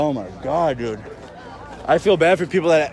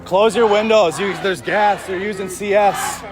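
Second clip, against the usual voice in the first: calling out; 4 semitones higher than usual. Real, not acted. Warning residents about tear gas.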